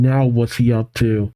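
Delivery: ordinary delivery, nothing marked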